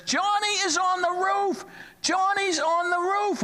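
put-on high, alarmed child's voice; a kid shouting for his dad; child calling out. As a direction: cry out like a frightened boy. Boy calling out about brother.

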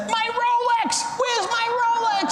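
very high, frantic shriek; panicked shout. Acted. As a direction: shriek in horror over a lost treasure. Stockbroker discovers his watch is gone.

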